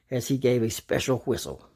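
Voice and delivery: ordinary delivery, nothing marked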